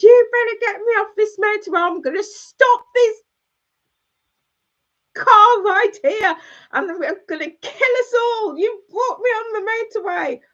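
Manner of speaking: high panicked yelling at her friend; retold screaming meltdown. Woman screaming at her friend to get off the motorway, panicked. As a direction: shriek it in a full freak out. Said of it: "I was screaming, I was swearing".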